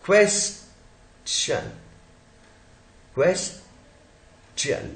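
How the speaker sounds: "question" split as "kwes... chan"; pause, then a clear च in "question"; CH sound. Shown as a demonstration. Teacher points out च to students, CH sound. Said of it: "च है ये".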